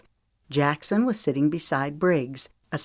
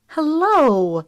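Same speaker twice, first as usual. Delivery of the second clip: warm hello; 11 semitones higher, 6 dB louder than usual. A narrator's reading. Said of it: "Zoe said warmly".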